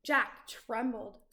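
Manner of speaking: usual speaking voice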